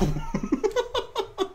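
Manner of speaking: sudden high giggle; high laughing burst. Real, not acted. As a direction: crack up at yourself. Laughs at own advice.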